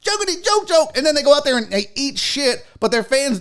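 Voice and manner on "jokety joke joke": high, giggly mock comic with silly boops; higher, laughing send-up of a bad comedian; mocking wannabe comic. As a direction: parody a clueless celebrity doing stand-up. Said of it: "the equivalent of them being like".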